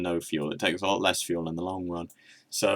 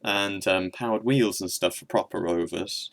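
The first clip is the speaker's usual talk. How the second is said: normal talking voice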